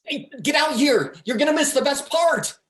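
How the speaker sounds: high, loud call to the next room; excited shout across the apartment; calling offstage. Acted. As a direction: yell for your friend to come see. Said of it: "Off stage".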